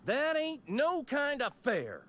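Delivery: high, slow, drawn-out complaint; indignant protest. Acted. Rival who lost the ruling, indignant protest.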